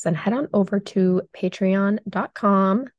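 usual speaking voice

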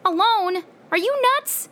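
very high, incredulous questions; shocked disbelief. Acted. Friend hears he entered the cave alone, shocked. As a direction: shoot each question up in alarm.

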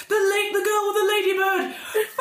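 high-pitched mock panic cry; panicked bandits. Bandits run away from the girl, hammed-up panic. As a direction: squeal it like a fleeing bandit.

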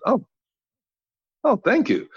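high, relieved oh; playing it off with a high relieved oh; mock relief. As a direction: gush a falsely relieved thanks. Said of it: "And I said".

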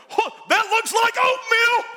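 pastor imitating Coach Tommy Parks inspecting vomit, mock excited shout. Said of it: "He'd, he'd run up and go".

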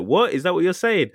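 high, incredulous comeback; mock indignant disbelief. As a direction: act outraged by what he heard. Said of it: "And then he's like".

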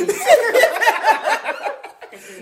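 long high-pitched laugh; cracking up. Real, not acted. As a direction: keep laughing, high and helpless. Group cracks up at joke.

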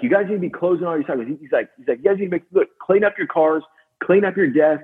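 lit up lecture. Retold or imitated. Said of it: "this guy was lit up on it".

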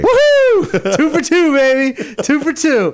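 high, loud victory whoop; excited cheering. Real, not acted. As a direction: cheer the win, laughing. Cheering after winning trivia.

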